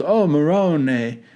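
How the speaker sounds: put-on slow exasperated Jersey Italian accent; Italian-American accent. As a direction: drawl it like a Sopranos mobster. Mock Italian exclamation.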